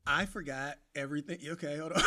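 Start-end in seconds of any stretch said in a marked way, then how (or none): none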